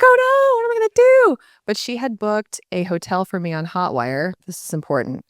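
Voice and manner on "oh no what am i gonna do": very high, louder panicked squeal; mock panic. Mom not answering, hammed-up high panic. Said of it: "I was like".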